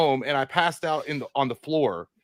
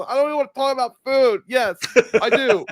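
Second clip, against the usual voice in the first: high, loud imitated drunk protest; drunken whining; 9 semitones higher, 5 dB louder than usual. Drunk passenger answering his partner about fast food, high whining. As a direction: whine it out, then cave in.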